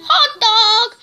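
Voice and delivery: high, loud character catchphrase; Hot Dog. Acted. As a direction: call it out high, like the toy announcing itself. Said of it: "when you put him on, he goes".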